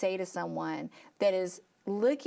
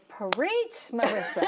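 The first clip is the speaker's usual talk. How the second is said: playful preach call; 3 semitones higher, 3 dB louder, slower than usual. Real, not acted. Host cheering guest after her testimony, laughing. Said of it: "All I can find to say".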